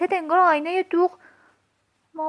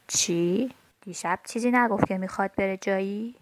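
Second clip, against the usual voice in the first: lower, softer voice asking back; lower, softer questioning voice; 7 semitones lower, 4 dB quieter than usual. Acted. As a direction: ask it as the other one would, puzzled. Child asks doll's question.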